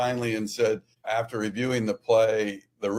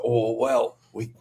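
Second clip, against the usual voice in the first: producers scrambling; 5 semitones higher, 4 dB louder than usual. Retold or imitated. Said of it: "they said".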